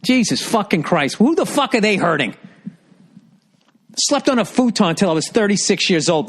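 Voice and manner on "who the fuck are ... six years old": ordinary talk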